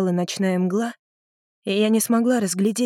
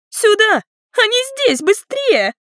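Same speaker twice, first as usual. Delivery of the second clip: very high, loud, urgent call; shrieked call; 13 semitones higher, 5 dB louder than usual. A narrator's reading. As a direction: scream for the others to come fast. Sister calls adults over. (истошно звать на помощь)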